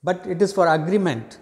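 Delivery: usual speaking voice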